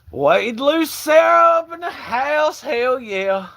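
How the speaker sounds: loud, high, drawn-out shout; real whooping announcer-style greeting; hollered welcome. Real, not acted. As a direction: holler a hype shout-out to a new arrival. Hollering welcome to arriving viewer.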